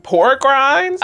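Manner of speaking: high, loud incredulous question; can't believe what you heard; high, loud amused disbelief; surprised. Real, not acted. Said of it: "I did not know you was like that".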